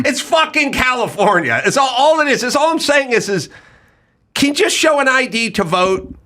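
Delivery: high, worked-up ranting; heated rant. Real, not acted. Comic ranting to co-hosts about voter ID, heated.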